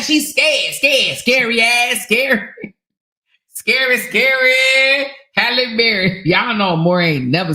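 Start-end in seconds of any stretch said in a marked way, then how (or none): 0.0-5.1 slow singsong taunt; teasing a rival streamer, singsong. Real, not acted. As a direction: drag out scary like a playground taunt.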